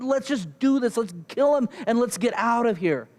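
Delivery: high-pitched, insistent argument; mock yelling. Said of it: "Okay, I'm yelling".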